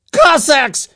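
high, loud scream; desperate, furious yell; screamed insult. A narrator's reading. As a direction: hurl the word as an insult. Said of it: "he screamed".

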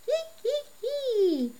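put-on high creaky old man's giggle; creaky old man cackle. A narrator's reading. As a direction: cackle like creaky worn steps. Old man's creaky laugh again.

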